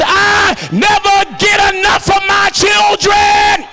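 loud, high, shouted preaching over music; preacher rallying the church, singing shout; full-force preaching. Acted. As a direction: build it back up to a shout.

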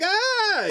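high-pitched yelp of fright; imitated panicked shriek; mock scared scream. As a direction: cry out as if fleeing in fear. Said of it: "now I'm scared".

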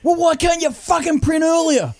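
exasperated high-pitched mock yelling; irate dad. Dad scolds son for printing late, mock irate yell. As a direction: imitate a fed-up father hollering. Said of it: "And he'd go".

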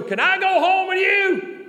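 high, loud, drawn-out mock holler; big woman's eager plea. Preacher voices a woman begging a ride home, mock holler. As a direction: belt out her request, stretched slow and high.

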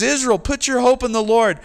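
calling out. Acted. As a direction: call them up to hope. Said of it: "He says".

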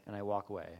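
ordinary talk

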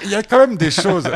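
laughing loudly while teasing. Real, not acted. Host teasing the guest about women, laughing. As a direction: chuckle through the words.

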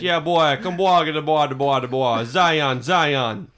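loose, repetitive Jamaican patter; Jamaican accent. Shown as a demonstration. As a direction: riff in a mock Jamaican accent.

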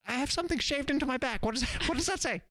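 a monkey just waking up to speech; smart monkey. Acted. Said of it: "you do the monkey".

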